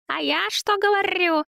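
very high, cooing flattery; babbling, fussing woman. Acted. Zina soothes angry Vova, high fawning babble. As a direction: coo and fuss to soothe him.

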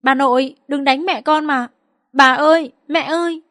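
put-on high-pitched voice of a pleading child; narrator's imitation of a child begging grandma; sobbing plea. A narrator's reading. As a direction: plead like a tearful little boy, lightly acted. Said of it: "nức nở van xin".

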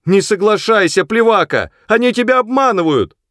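high, loud cry of alarm; warning shout. A narrator's reading. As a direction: shout to warn a friend far away. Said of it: "крикнула Сэдди". (громкий предостерегающий крик)

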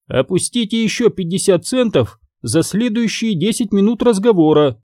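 high-pitched telephone operator; high, impersonal operator announcement; entirely new voice. A narrator's reading. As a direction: recite it like a payphone operator in a high voice. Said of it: "совершенно новым голосом".

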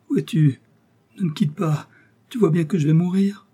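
high, distressed pleading; dying plea. A narrator's reading. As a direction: beg not to be left alone. Dying woman begging her lover. (supplication de mourante, voix aiguë)

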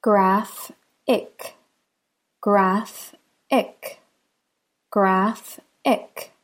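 "Graph-ic" split by a clear pause; syllable by syllable. Shown as a demonstration. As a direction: break "Graph-ic" into two beats, graph and ic. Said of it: "here there are only two syllables".

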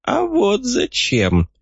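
put-on high, slow sly old codger; smirking old man. A narrator's reading. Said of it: "усмехнулся старикашка".

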